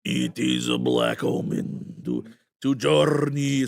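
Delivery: slow, rasping, eerie vampire intonation; Nosferatu voice. Retold or imitated. Joining the TikTok Nosferatu trend, slow eerie rasp. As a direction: drag each word out like the ancient count. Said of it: "everybody was doing the".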